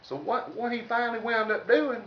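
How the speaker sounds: ordinary delivery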